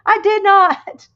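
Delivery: high, loud, drawn-out mock protest; retold indignant denial. Denying to her friend she said it, retold indignant protest. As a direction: protest slowly in playful outrage. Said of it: "And I was like".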